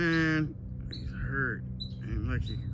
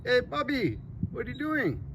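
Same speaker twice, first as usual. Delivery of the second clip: much higher, sing-song calling; high pet-talk voice; 12 semitones higher than usual. Real, not acted. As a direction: call out like to a kitty. Calling to the bobcat.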